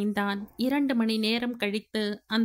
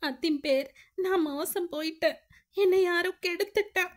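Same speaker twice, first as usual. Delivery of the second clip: put-on higher, rushed lines like a sobbing young woman; sobbing girl; 8 semitones higher, faster than usual. Anu telling her brother-in-law she was assaulted, sobbing. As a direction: break down as you tell him. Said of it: "Vicky Vicky அழ ஆரம்பித்தாள்".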